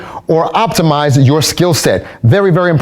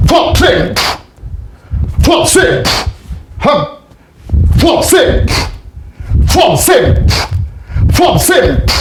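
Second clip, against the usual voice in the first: high, barked martial arts count, much higher; calling drill counts; 8 semitones higher, much slower than usual. Acted. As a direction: call each count sharp and high like a drill instructor. Instructor calls counts for drill.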